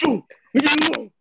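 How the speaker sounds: high-pitched imitation of an agitated friend; mimicked upset brush-off. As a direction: act out his whiny plea to be left. Acted phone quote of upset friend.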